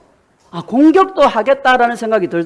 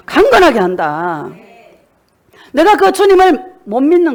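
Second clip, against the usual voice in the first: fervent preaching; 4 semitones higher, 3 dB louder than usual. Acted. 교인들에게 주님의 약속을 외치는 열정적인 설교. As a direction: press each promise on the congregation with rising heat.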